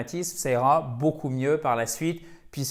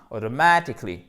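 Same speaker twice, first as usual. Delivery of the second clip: AUTOMATICALLY with MA punched louder; rythme accentué; 3 semitones lower, 4 dB louder than usual. Shown as a demonstration. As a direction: land hard on MA in "automatically", then drop. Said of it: "Il y a vraiment un rythme". (automatically rythmé, MA bien accentué)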